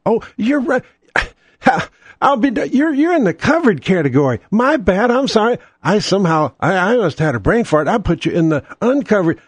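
high, sugary fake apology; mock insurer apology. Insurer fakes sorry to a cheated customer, high and sugary. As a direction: play the insurer's phony sorry. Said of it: "That's what they do".

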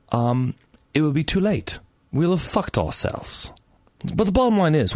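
imitating an English scientist dad; tipsy attempt at an English accent. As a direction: explain earnestly in a put-on English accent. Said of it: "should I do this in an English accent".

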